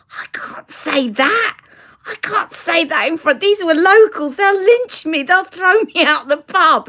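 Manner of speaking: high-pitched, mock-horrified protest; panicked protest. Daughter begging mum not to shame her before locals, panicked protest. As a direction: plead in a high, embarrassed panic.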